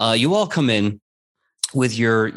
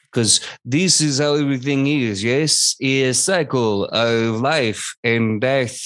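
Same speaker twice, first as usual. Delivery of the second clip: Professor Marrow. Acted. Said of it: "Professor Marrow turns around to everyone".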